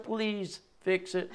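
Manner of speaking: quiet, slow plea to fix it; soft pleading request. Retold or imitated. Preacher asking Janessa to fix the remote, softly pleading. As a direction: ask sweetly for help, slowly. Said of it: "I gotta give it to Janessa".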